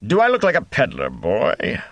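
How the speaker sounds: grumbling. A narrator's reading. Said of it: "the Gleeman grumbled".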